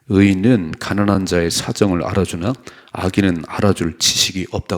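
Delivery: loud, steady unison reading of the verse; scripture read aloud. Real, not acted. Pastor leads the congregation in reading, loud and steady. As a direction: read the proverb out together, loud and even.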